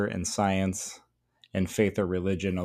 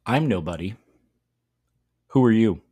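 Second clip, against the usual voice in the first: measured, deliberate poem recital; poem reading. Shown as a demonstration. Teacher reading the poem to students. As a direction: read the poem aloud for the class.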